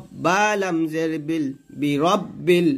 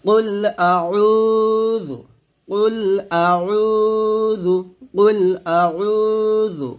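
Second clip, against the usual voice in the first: আউযু drawn out high and slow; slow melodic recitation; 3 semitones higher, much slower than usual. Shown as a demonstration. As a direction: chant it slowly, drawing the vowel. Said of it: "আমার সাথে আবার পড়েন".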